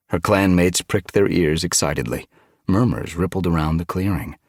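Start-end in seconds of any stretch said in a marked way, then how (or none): none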